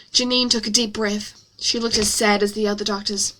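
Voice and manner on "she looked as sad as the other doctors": ordinary delivery, nothing marked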